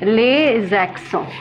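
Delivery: les accents, slow and careful French; les accents pronounced the French way; French pronunciation. Shown as a demonstration. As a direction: say les accents slowly with a French nasal end. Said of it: "Pronounced as".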